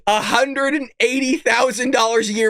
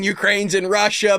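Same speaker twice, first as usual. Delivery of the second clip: normal talking voice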